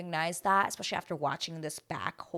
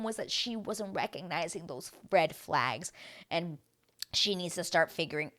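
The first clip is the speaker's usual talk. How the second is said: ordinary talk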